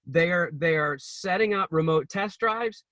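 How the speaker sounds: ordinary talk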